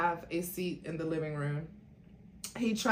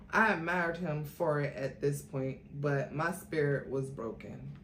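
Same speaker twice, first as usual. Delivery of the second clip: usual speaking voice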